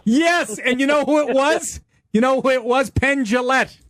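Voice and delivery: high, thrilled "yes"; host bursts in, thrilled to tell it; excited. Real, not acted. Host eagerly recalling the Sopranos scene, excited.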